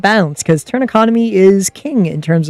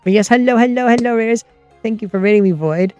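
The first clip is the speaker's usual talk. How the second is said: cute anime streamer; 4 semitones higher than usual. Real, not acted. Greeting raiders again.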